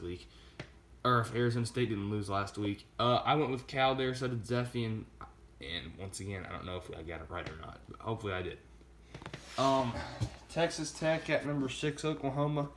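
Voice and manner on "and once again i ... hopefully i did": low, soft, quick aside; quiet aside. Real, not acted. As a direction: mumble it quickly under your breath. Repeated hedge, dropped to aside.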